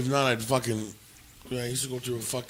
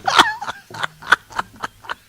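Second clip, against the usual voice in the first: a sudden high burst of laughter; real loud laugh; 8 dB louder than usual. Real, not acted. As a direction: crack up at your own joke. Laughs after joke about isolating.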